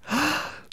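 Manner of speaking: a sharp, high gasp of wonder; awed gasp. Acted. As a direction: gasp at the sight. Professor marvels at silver dust.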